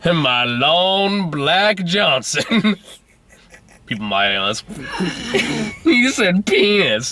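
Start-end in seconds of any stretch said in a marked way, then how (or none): 0.1-2.8 slow drawn-out boast; mock macho boast. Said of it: "He was bragging about it". 5.9-7.1 high slow schoolkid taunt; childish teasing. Pretend.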